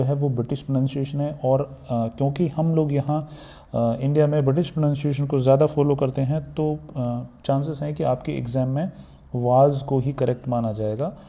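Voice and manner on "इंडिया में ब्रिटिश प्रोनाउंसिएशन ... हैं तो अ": ordinary talk, nothing marked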